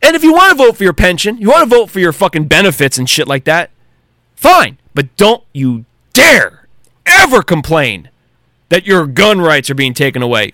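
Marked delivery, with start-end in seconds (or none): none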